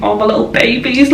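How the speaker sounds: high-pitched fond coo; adoring coo. Retold or imitated. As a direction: coo it like fussing over babies. Gushing over adorable friends.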